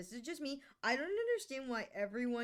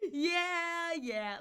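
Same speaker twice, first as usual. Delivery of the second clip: ordinary delivery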